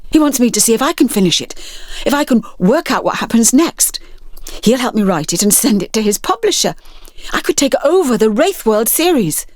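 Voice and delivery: fast, high, tumbling words; can barely hold it in; bursting with excitement. Acted. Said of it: "almost bursting with excitement".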